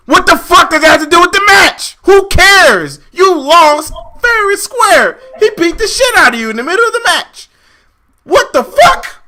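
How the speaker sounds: high, loud rant building to a shriek; real furious exasperation; escalating outrage. Real, not acted. Escalating outrage at contract excuse.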